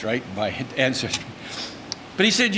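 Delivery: normal talking voice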